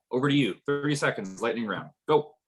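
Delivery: normal talking voice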